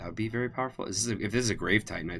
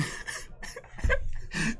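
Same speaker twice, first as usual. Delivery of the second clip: high laugh; 4 dB quieter than usual. Real, not acted. Laughing at joke.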